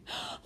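a short shocked gasp; sharp gasp. Acted. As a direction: gasp in surprise. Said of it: "Lady Elvira gave a cry".

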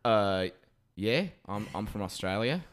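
hesitant, badly faked Australian accent; stall, then try an Australian accent; Australian accent attempt. Cashier caught faking it, in a hesitant Australian accent. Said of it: "now I'm trying to do an Australian accent".

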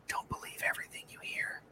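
hushed conspiratorial aside; retold sly, voiceless confidence; whisper. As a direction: whisper it like a secret.

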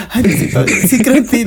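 high, comic plea to God; hammed-up frightened believer; mock pleading. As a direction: beg heaven in playful panic. Acts sudden pious pleading from fear.

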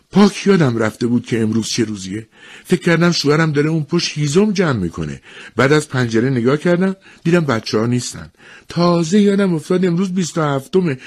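high, animated woman's voice; flustered village woman. Acted. Latecomer to the woman beside her, flustered and high. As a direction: play a woman who has come rushing in. Voices hurried Mrs Hutchinson.

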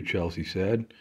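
ordinary delivery

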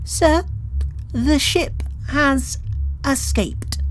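high, slow and trembling with fear; a terrified junior officer reporting; shaking lieutenant. Acted. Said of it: "A shaking lieutenant".

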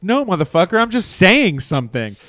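exasperated retort. Retold or imitated. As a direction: push back hard at a friend. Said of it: "I'm like".

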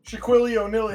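"Shaquille O'Neal" garbled as Shaquillio-Nilly; kid's mispronunciation. Retold or imitated. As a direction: imitate the kid mangling "Shaquille O'Neal". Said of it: "how did that kid pronounce".

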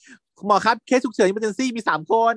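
high, slow urgent nurse report. Retold or imitated. Nurse bursting in to tell the doctor, urgent call. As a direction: announce the emergency like a nurse bursting in. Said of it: "พยาบาลเปิดประตูเข้ามา".

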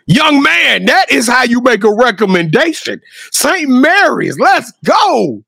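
big slow rallying call; hyped-up. Acted. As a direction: stretch it out like a pumped-up announcement. Hyped-up cheer for a recommendation.

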